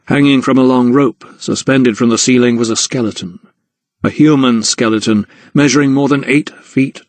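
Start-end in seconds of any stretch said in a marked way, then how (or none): none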